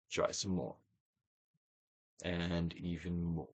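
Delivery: slow, soft and low urging; gentle hushed encouragement; soft prompt. Acted. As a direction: ease them on quietly. Repeated releasing prompt.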